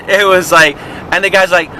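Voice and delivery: ordinary talk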